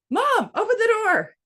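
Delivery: put-on high, loud child's call through the door; kid calling out. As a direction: call mom like an impatient little boy. Son calling through bathroom door.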